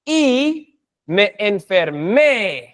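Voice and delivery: "me enfermé" said slowly, stress on "me"; slow Spanish model. Shown as a demonstration. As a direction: draw out the phrase for students to copy. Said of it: "has to have the me".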